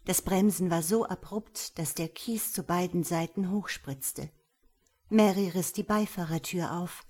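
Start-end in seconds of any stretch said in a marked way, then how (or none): none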